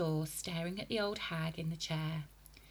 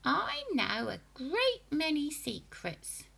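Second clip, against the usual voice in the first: put-on high, slow sinister old hag; old woman whispering; 9 semitones higher than usual. A narrator's reading. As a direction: tease the secrets slyly. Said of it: "she said".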